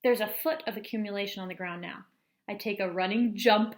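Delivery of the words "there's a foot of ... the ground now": ordinary talk, nothing marked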